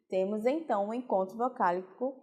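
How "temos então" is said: ordinary talk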